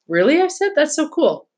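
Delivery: put-on excited, high-pitched girlish wonder; delighted surprise, voice lifting high; impressed. Charlotte hears her friend was born in Madrid, excited. Girl thrilled at friend's background.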